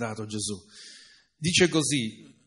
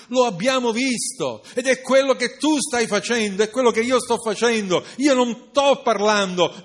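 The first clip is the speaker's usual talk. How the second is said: higher, louder fervent preaching; preaching at full force; 7 semitones higher, 6 dB louder than usual. Acted. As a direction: hammer each "non" home to the congregation.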